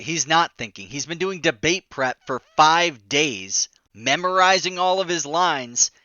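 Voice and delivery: ordinary talk, nothing marked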